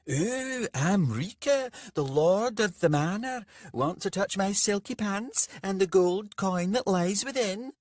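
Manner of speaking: high, loud mincing parody; mocking Rika. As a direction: camp it up, mocking the merchant. Bandit mocks Rika in his underwear.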